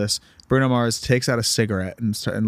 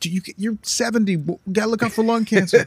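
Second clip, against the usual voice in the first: mock scolding voice; 10 semitones higher than usual. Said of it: "They'd be like".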